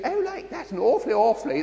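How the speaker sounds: high-pitched mock-posh English voice; exaggerated "awfully" posh English accent; posh English schoolboy accent. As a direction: parody a plummy English boy before his voice broke. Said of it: "they'd be speaking".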